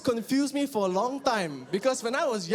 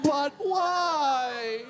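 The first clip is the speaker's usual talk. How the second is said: pleading cry; 4 semitones higher than usual. Acted. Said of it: "breaking up".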